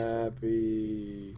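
slow, droning hat devotee; mind-controlled trance. Pretend. Devotee talking over doubting co-host, mind-controlled trance. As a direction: drone it slowly, as if hypnotised. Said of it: "complete and total mind control".

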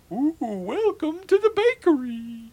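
put-on high, slow crackly old witch. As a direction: imitate the Scottish witch fortune teller. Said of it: "he walked out and he said".

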